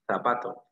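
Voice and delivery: "zapato" starting with a th sound; th-like Z opening "zapato"; Castilian Z. Shown as a demonstration. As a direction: say "zapato" as in Spain, tongue between the teeth. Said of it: "zapato con Z".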